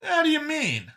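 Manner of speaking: very high-pitched curious question; curious Brian. Acted. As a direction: ask it curiously, voice pitched right up. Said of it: "looking curious".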